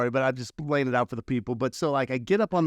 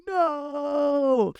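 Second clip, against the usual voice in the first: crowd answering; 12 semitones higher than usual. Retold or imitated. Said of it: "they're like".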